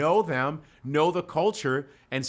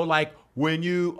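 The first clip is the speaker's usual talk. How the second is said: ordinary delivery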